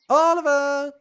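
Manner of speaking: calling out. Acted. Babysitter to missing Oliver, calling out. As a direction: call out for someone who has wandered off.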